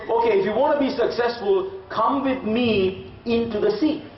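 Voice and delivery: put-on old man's urging. Old man urges the kid.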